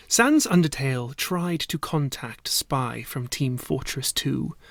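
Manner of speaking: ordinary delivery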